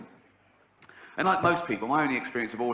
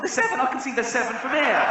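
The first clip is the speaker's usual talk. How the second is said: much higher, louder, yelling in disbelief; exasperated shout; 10 semitones higher, 4 dB louder than usual. Retold or imitated. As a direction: bellow it out in frustration. Angry outburst at the boy.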